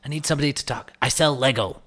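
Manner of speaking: hammed-up American salesman, cracking up; imitated American accent. American Lego seller asks for a voiceover, imitated American accent. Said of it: "he had an American accent".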